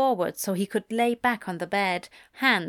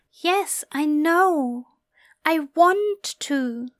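higher, slow character voice; Aziraphale voice; 7 semitones higher, 4 dB louder, much slower than usual. A narrator's reading. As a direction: voice him higher and slower.

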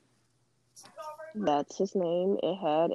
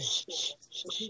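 whispered shushing to cut in; hushing; 2 semitones lower, 7 dB quieter than usual. Real, not acted. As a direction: hush them so you can finish. Hushing a friend who interrupts.

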